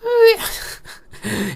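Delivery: very high wordless squeal; squeaky voice. Retold or imitated. Vlogger imitating his voice before it broke, squeaky. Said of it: "I had a really squeaky voice".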